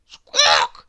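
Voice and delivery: loud, harsh cluck; chicken squawk. Acted. Chickens in the farmyard tangle, squawking. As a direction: squawk like a startled hen. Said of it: "clucked the chickens".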